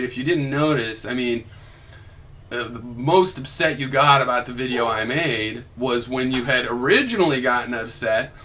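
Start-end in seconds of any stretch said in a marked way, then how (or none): none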